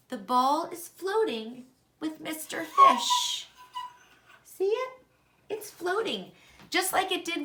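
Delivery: thrilled to see it float; The ball is floating said high and delighted; excited. Real, not acted. Excited discovery in experiment.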